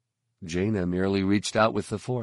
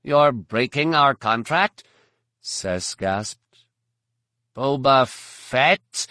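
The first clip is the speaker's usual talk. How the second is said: higher and louder, aghast; shocked alien gasp; 5 semitones higher, 5 dB louder, slower than usual. A narrator's reading. Sess gasping at the broken contract, aghast. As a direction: react as if betrayed.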